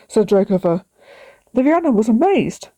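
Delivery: ordinary delivery, nothing marked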